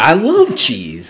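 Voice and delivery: slow crotchety old man impression. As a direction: croak it like a grumpy old man. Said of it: "he's like".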